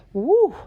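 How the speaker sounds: high, loud whoop of delight; excited whoop. Real, not acted. Vlogger cheering about her dream journals, a loud whoop. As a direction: let out a happy cheer.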